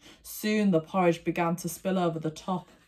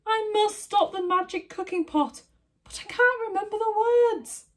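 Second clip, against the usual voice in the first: panicked mother; 4 dB louder than usual. A narrator's reading.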